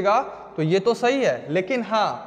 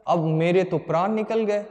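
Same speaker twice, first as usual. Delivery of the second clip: normal talking voice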